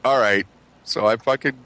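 ordinary delivery

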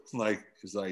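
ordinary talk

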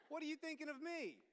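ordinary talk, nothing marked

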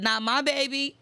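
laughing as she quotes God protectively. Retold or imitated. Quoting God's protective words while laughing. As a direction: say it through a laugh, delighted. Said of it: "He said".

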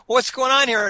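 high, startled question; mock-alarmed questioning; retold startled demand. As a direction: re-enact a surprised challenge, pitch jumping up. Retells own reaction to the scuffle.

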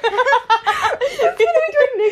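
loud, high laughing reaction; cracking up. Real, not acted. Friend reacts to an absurd score, cracking up loudly. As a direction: shriek it slowly through laughter.

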